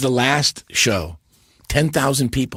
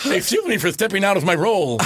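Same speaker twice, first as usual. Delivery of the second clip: lightly voiced Ed Wynn, higher; Ed Wynn line; 7 semitones higher than usual. Retelling the punchline to the hosts, light Ed Wynn voice. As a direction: repeat it in a light Ed Wynn voice through laughter. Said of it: "goes to the microphone".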